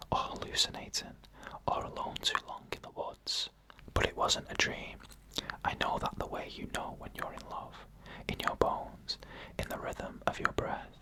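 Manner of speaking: ordinary delivery, nothing marked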